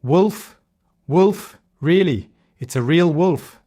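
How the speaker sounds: high, loud pleading yell that is still a prank. Acted. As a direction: insist it is real to fool them. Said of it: "shouted even louder".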